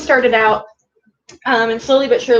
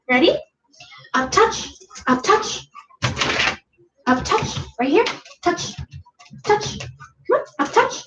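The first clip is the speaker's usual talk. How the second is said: high rising up touch cue; talking to a dog; 8 semitones higher than usual. Real, not acted. Cueing dog at target.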